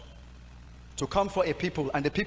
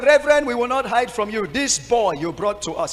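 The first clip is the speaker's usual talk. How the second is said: raised, indignant woman's voice; choir mistress complaining; 7 semitones higher, 8 dB louder than usual. Retold or imitated. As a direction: play the affronted choir mistress, loud. Preacher acts out choir mistress complaint.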